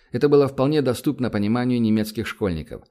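usual speaking voice

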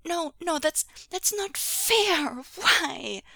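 high, loud wailing objection; drawn-out, high-pitched whine of distress; upset child's protest. A narrator's reading. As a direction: protest like a distraught little kid. Child upset about hurt father.

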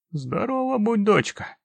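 put-on mumbling toothless old man. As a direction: mumble it like an ancient grandpa. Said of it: "прошамкал дедуля".

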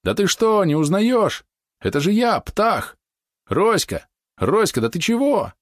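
крики; shouting. A narrator's reading. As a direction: shout to an old friend across the water. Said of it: "донеслись новые крики".

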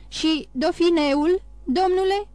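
slow, rising, high anxious query; slow anxious question. A narrator's reading. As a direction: draw out the worried question to the magistrate. King anxiously questions Villefort.

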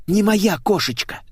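high-pitched nervous repeat. A narrator's reading. Blonde insists the car is not hers, nervous. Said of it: "нервно повторила Светик".

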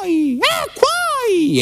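high, very slow call from afar; distant cry from above. Retold or imitated. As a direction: cry out from a balcony, high and drawn out. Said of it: "un grido piove dal cielo".

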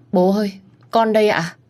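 high, emotional reunion cry; tearful call. Acted. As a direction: call out to father. Daughter returns to shocked father. (con gái gọi bố đầy xúc động)

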